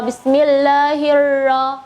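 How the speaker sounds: bismillahirra drawn out slowly with a long trilled r; over enunciating. Shown as a demonstration. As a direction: bismillahirra slowed, rolling the ra strongly. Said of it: "harusnya lebih jelas lagi getaran huruf ra-nya".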